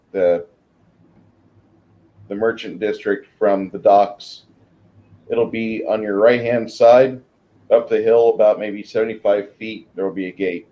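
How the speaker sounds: ordinary talk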